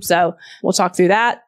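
ordinary talk, nothing marked

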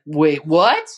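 loud, rising mock surprise; surprised what. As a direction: blurt it in disbelief. Mock disbelief at documentary.

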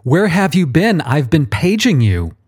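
high, impatient boss voice; a superior scolding his underling; Archangel. A narrator's reading. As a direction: voice him high and demanding. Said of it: "the Archangel begins".

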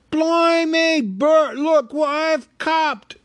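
high, loud, slow troll voice; troll William. Acted. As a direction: crow over a lucky catch, troll-style. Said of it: "said William".

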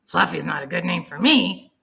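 loud, gruff threat; tough biker guinea pig. Acted. Guinea pig warns the imaginary Fluffy off his food, gruff and loud. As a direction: bark it like a grizzled biker. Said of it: "biker with a pack-a-day habit".

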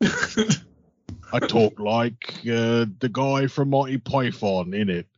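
slow hammed-up British accent. Co-host teasing as a Brit, hammed-up British accent. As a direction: draw out a mock Monty Python British accent. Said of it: "doing his British accent".

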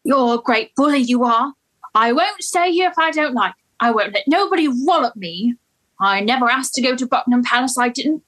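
put-on indignant Cockney flower girl protesting; Cockney accent, defiant and wounded; Cockney Eliza. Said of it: "[name]'s accent was so good".

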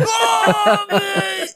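high, loud oh man; touched surprise. Retold or imitated. Opening the box from Mom, high loud surprised. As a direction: gasp it out, moved. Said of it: "And I was like".

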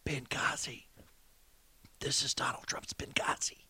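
breathy conspiratorial whisper; mock whisper. Host mocking the media's glee, whispering. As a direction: hush it like a gleeful secret.

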